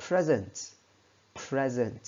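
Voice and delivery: "present" stressed on the first syllable, falling away; "present" as the noun, punch PRE. Shown as a demonstration. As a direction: say "present" as PREZ-ent, second syllable weak. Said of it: "với nghĩa là món quà thì các bạn phải đọc là".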